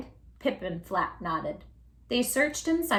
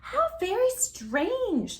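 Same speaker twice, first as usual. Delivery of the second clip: high, slow wondering voice; murmured; 12 semitones higher, much slower than usual. A narrator's reading. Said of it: "they all murmured".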